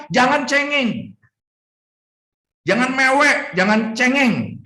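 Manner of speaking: high, slow teasing rebuke; mocking scold. God telling Job not to whine, teasing high scold. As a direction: chide him like a whining child.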